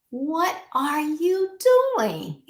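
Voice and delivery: high, slow, drawn-out call for attention; child calling out. Retold or imitated. Child calling a distracted parent, high and drawn out. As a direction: stretch it out like a kid who's been ignored.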